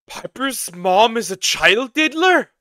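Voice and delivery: loud slow outraged question; shocked disbelief. Real, not acted. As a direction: ask it in stunned outrage.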